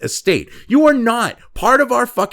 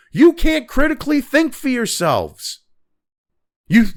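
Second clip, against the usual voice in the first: normal talking voice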